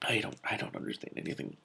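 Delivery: soft unvoiced whisper; defeated whisper. Real, not acted. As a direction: give up in a breath. Reader overwhelmed by the book.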